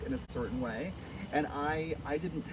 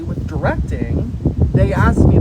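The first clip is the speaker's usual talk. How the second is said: ordinary delivery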